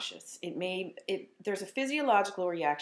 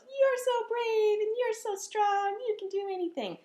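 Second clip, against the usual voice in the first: imitating daughter. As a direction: praise sweetly like a small child. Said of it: "And then she goes".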